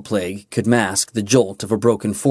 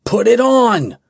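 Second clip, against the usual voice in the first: higher, louder shout; 12 semitones higher, 4 dB louder than usual. A narrator's reading. Kaelin shouts at Mirren to put on the ring, loud. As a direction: shout it over the storm. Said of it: "he shouted".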